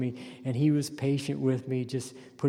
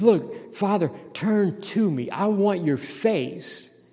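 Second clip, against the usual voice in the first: loud, slow, earnest plea to God; impassioned prayer of David; pleading as David; 4 semitones higher, 5 dB louder than usual. Acted. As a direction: beg God like a desperate king. Preacher voicing David's plea.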